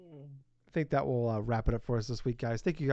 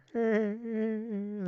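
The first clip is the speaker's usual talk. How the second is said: half-assed cartoon chuckle, pitched up; higher, repetitive put-on snicker; Peter Griffin laugh; 8 semitones higher than usual. Shown as a demonstration. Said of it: "a half-assed Peter Griffin laugh".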